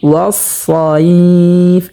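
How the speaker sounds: very slow, drawn-out tilawat chant; slow melodic Quranic recitation. Shown as a demonstration. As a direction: recite each word with long held vowels. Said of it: "যেমন".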